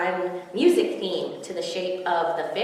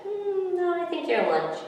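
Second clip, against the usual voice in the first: sly cat; 10 semitones higher than usual. Acted. Cat renames fish.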